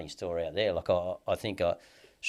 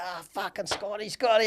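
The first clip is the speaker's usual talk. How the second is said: high-pitched retold cry of recognition; retold greeting, rising in pitch and volume; delighted recognition; 12 semitones higher than usual. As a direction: imitate an old mate placing you. Inmate recognises him, retold.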